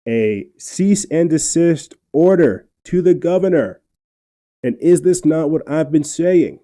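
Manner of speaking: slow, loud and high, worked up; fired up. Real, not acted. Fired-up host driving home his point, loud and emphatic. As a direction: lean on every word, fired up. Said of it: "this is probably why I'm getting fired up".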